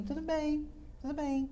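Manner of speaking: high, forced tudo bem; fake fine. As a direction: pretend everything is fine. Finge estar bem. (fingindo que está tudo bem)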